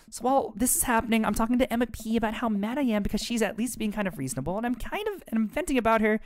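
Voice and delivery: put-on teen daughter voice. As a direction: play the upset teen daughter ranting. Voices Amanda again.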